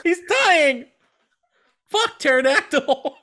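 high, loud frantic toy voice; frantic mock medic. Toy soldier yelling at the medic dinosaur: high, loud panic. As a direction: panic like a battlefield medic, hammed up. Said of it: "He's like".

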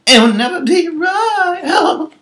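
very high, slow, thin ghostly croon; eerie singer impression. Mocking [name]'s performance as a creepy horror ghost, eerie high croon. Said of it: "She was like".

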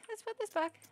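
ordinary delivery

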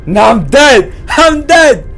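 screaming I'm dead at the scare; loud panicked yell; real shout. Real, not acted. Streamer shouts after the game scares him, real scream.

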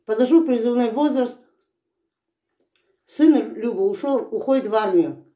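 chewing while talking; eating melon while speaking; mouth partly full. Real, not acted. Seen 4: holds melon, puts piece in mouth, chews between words.

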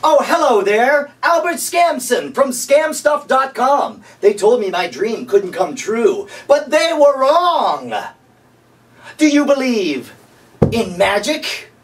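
cheesy infomercial host. Acted. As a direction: greet the viewer like a late-night TV huckster. Infomercial character Albert Skamson.

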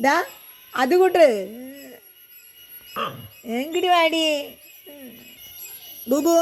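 high, pleading call to the dog; calling the pet. Real, not acted. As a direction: coax him over, pitched well up. (ഉയർന്ന സ്വരത്തിൽ നായയെ വിളിക്കുന്നു)

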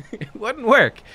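laughing it off. Real, not acted. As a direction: laugh through the words. Laughing after the bit.

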